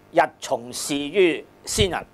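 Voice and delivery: usual speaking voice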